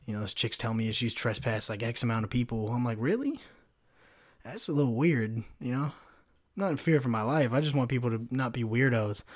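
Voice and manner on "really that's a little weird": sceptical disbelief. Retold or imitated. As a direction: question it softly, pitch shooting up. Retold sceptical reply to coworker.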